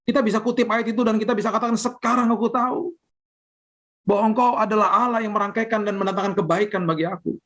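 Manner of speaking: impassioned sermon climax, pitched high; fervent preaching. Acted. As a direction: lift the congregation with growing passion. Preaching rises to full force.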